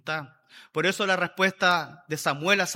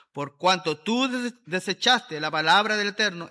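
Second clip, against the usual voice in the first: louder, proclaiming Bible reading; scripture reading; 4 dB louder than usual. Acted. Preacher reading the Bible to his congregation, proclaiming. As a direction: read the verses out like a proclamation. Rereads the verse aloud.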